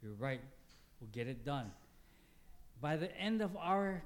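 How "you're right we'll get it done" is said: low, soft soothing reply; calm the upset stranger quietly; gentle answer. Retold or imitated. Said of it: "and I told him".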